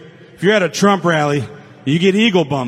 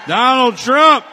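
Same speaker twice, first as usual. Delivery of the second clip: higher and louder crowd hype; rally shout; 6 semitones higher, 5 dB louder, slower than usual. Real, not acted. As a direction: belt the name to the crowd. Hypes the crowd with the name.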